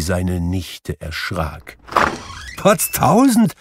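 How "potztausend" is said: high-pitched exclamation of amazement; startled cry. A narrator's reading. Nephew Fred startled by an unexpected visitor, crying out. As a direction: yelp it out in sudden surprise. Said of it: "rief Fred".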